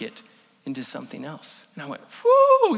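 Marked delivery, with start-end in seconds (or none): 2.2-2.8 excited whoop. Real, not acted. Reacts to potter's lesson.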